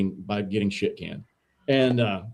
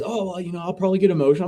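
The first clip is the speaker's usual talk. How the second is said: higher-pitched imitation of the HR rep; retold HR woman; 6 semitones higher, much faster than usual. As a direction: lightly put on her apologetic voice, pitched up. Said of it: "she goes".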